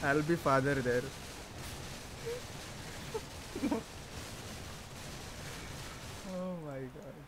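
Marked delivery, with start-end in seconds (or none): 1.1-6.3 long voiceless laugh, softer; long silent laugh. Real, not acted. Streamer laughs voicelessly at his own priest joke. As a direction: shake with laughter without sound.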